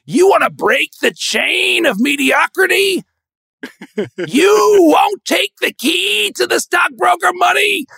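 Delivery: high, loud, slow indignant shouting; mock outrage. Elders scolding a son who won't be a stockbroker, shouting. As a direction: shout it like an appalled rich elder. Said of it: "They're like".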